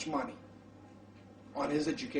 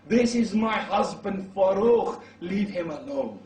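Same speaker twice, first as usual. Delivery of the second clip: loud, higher cry, drawn out then easing; retold shout; 5 semitones higher, 5 dB louder, slower than usual. Wife defending her returned husband before the crowd, shouting. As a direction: call out over a crowd, slower and louder. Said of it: "She shouts".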